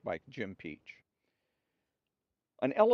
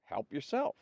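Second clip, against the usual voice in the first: half smiling; 3 semitones higher than usual. A narrator's reading. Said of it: "half smiling to herself".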